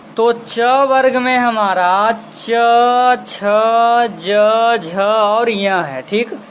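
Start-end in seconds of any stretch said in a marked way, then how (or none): 2.1-5.8 slow letter demo. Shown as a demonstration. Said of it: "आप इसे बोल करके देखिए".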